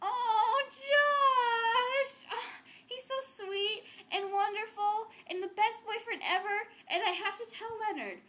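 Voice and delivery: high, loud squeal at the name, then slow gushing; gushing excitement. Acted. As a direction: swoon over the boyfriend's text. Boyfriend finally texts back.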